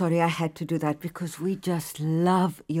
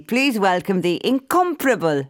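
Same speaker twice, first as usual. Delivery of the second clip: grand host intro; 5 semitones higher than usual. Acted. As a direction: build her up like a star.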